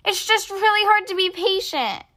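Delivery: high, exasperated exclamation; exclaiming. A narrator's reading. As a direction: burst out about waiting. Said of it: "Henry exclaims".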